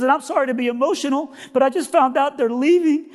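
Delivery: high-pitched overwrought woman; high, worked-up put-on voice; emotional cleaning lady.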